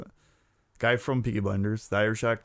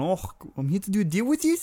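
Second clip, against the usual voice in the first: put-on South African arms dealer voice, much higher; playful South African accent, pitched well up; imitating South African accent; 10 semitones higher, 3 dB louder than usual. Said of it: "Timmy talks like".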